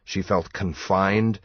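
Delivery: ordinary delivery, nothing marked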